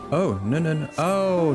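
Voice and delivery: ordinary delivery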